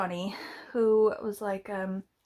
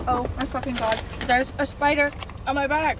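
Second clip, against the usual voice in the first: panicked; 4 semitones higher, 5 dB louder than usual. Real, not acted. Spider found on her back.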